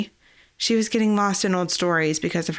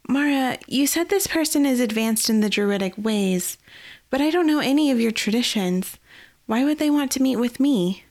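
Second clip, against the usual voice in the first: put-on higher, earnest young woman's voice; young Eliath, uneasy; 6 semitones higher than usual. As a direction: ask her mentor carefully, a little anxious. Eliath asks Mara.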